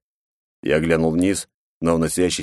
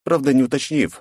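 ordinary delivery

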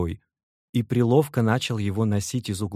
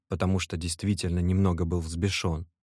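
ordinary delivery

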